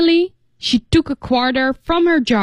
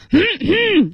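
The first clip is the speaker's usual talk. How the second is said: mock throat clearing; 10 semitones higher than usual. Shown as a demonstration.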